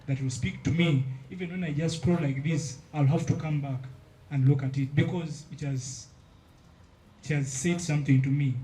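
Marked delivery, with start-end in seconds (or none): none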